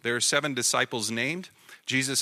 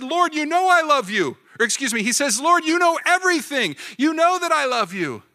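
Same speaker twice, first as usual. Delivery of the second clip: higher, louder hurt plea; 11 semitones higher, 7 dB louder than usual. Retold or imitated. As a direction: press it, stung by the question. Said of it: "Peter was hurt".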